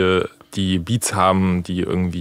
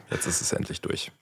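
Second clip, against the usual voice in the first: whispered, far softer than usual; hushed repeat; 12 dB quieter than usual. Real, not acted. Guest relieved after sleepless nights, hushed.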